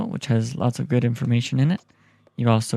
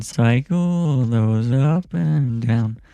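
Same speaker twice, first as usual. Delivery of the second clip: auto-tune; much slower than usual. Shown as a demonstration. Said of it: "we have some auto-tune".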